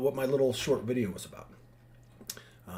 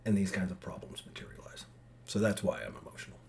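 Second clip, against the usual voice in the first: soft whispered aside; hushed weary whisper; 5 semitones lower, 7 dB quieter than usual. Real, not acted. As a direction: let it slip out under your breath.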